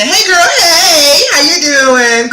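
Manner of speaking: shouting a happy welcome to Christina; excited greeting. Real, not acted. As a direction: call out a big slow hello.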